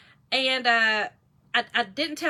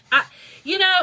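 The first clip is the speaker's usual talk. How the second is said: laughing; 6 semitones higher, 7 dB louder, faster than usual. Real, not acted. Bursts out laughing.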